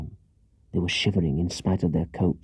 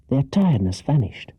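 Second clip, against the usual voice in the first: ordinary delivery, nothing marked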